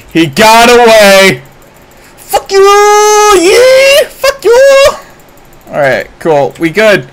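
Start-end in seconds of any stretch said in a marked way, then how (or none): 0.1-1.4 loud, high cry of protest; exasperated yell. Real, not acted. Streamer watching an enemy escape, exasperated yell. 2.2-4.9 very high falsetto shrieks; squealing yell. Real, not acted. Streamer yelling at the enemy, high squealing taunt.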